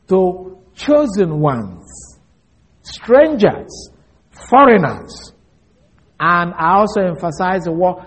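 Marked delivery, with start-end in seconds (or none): 0.1-5.3 chosen, strangers, foreigners each stressed slowly; emphasized reading. Shown as a demonstration. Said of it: "I emphasize the following".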